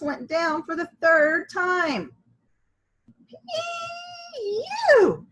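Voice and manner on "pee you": high, loud disgusted cry; crowd crying out. A narrator's reading. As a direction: shout it like a grossed-out crowd. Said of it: "cried the crowd".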